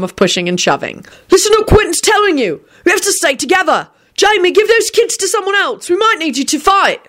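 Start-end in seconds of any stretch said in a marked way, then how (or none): 1.3-7.0 high, urgent yelling; rally them with high-pitched shouts; yelled out. A narrator's reading. William to the panicking crowd, urgent yelling. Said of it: "William yelled this out".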